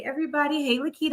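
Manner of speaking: normal talking voice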